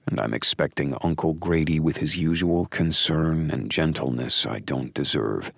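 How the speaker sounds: ordinary delivery, nothing marked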